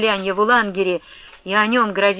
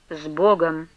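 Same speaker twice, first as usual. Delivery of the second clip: lower, restrained farewell; curt dismissal; 4 semitones lower, slower than usual. A narrator's reading. Manefa showing Ustinya the road, restrained dismissal. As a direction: dismiss her with finality. Abbess's one-word dismissal.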